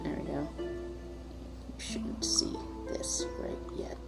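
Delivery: muttered under the breath; whispering. Real, not acted. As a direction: whisper it to yourself. GM muttering to himself at map.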